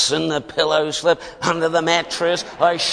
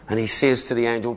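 ordinary delivery

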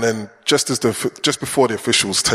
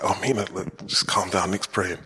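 put-on softly hushing a restless child, softer all through; hushed to child; 2 semitones lower, 7 dB quieter than usual. Hushing child during prayer.

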